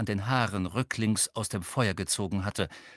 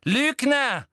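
high, loud accusation; outraged high-pitched yell; angry shout; 14 semitones higher, 7 dB louder than usual. A narrator's reading. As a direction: scream the word at the culprit. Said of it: "rief Umbridge".

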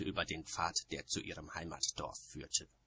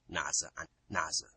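usual speaking voice

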